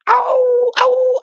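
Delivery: very high yelping howl imitating the dog; dog's high howling ow; coyote howl. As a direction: cry out like a coyote in pain, ow ow ow. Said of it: "like a goddamn coyote".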